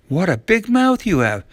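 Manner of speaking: ordinary delivery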